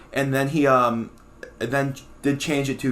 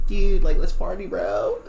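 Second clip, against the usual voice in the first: louder, higher party-bro quote; 4 semitones higher, 4 dB louder, slower than usual. Retold or imitated. Host mocking own nasally voice, party bro. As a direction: toss it off like a hyped frat guy. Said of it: "I have a nasally voice 'cause I say".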